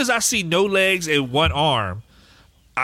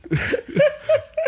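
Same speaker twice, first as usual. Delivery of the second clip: high laugh. Real, not acted. Laughing hard.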